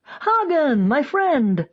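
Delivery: high, hearty welcome; delighted greeting. A narrator's reading. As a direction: greet an old friend with open arms. Stallkeeper greeting Koch warmly.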